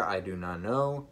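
ordinary talk, nothing marked

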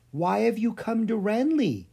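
tender, careful ask; gentle. A narrator's reading. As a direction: coax the creature kindly. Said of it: "he asked gently".

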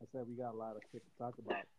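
muffled, far from the mic; bad mic, muffled audio. Real, not acted. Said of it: "speak up, bro. I can't hear you".

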